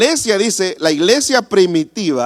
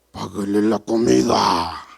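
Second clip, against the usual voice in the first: slow, deep, hammed-up divine command; overdone voice of God; 8 semitones lower, slower than usual. Preacher overplays God's order to pay, slow deep voice. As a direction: drag it out low, as an exaggerated booming God. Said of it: "No, no tanto así".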